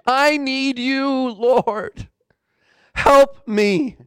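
high, drawn-out plea for help; humbled plea. Acted. Humbled dying man crying to the Lord, pleading. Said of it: "The other man was totally humbled".

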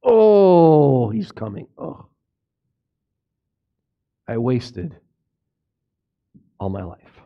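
a believer who wasted their life, dreading Christ's return, low and hushed; cowering dread. Pretend. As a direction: shrink back as judgment arrives. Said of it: "Some of you would cower in that".

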